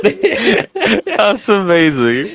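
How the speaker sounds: slow, stretched out through laughter; laughing. Real, not acted. Friend still laughing at the movie story, laughing.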